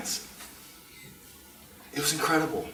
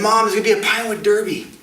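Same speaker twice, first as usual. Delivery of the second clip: fast, loud, higher excited rush; excited kid; 5 semitones higher, 6 dB louder, much faster than usual. Retold or imitated. Kid running home to tell mom, excited. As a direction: burst in with the big news.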